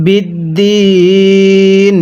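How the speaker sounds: বিদ্দিন drawn out very slowly; slow recitation. Shown as a demonstration. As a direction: stretch বিদ্দিন in chant. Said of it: "মিলিয়ে পড়ুন।". (বিদ্দিন খুব টেনে তিলাওয়াত)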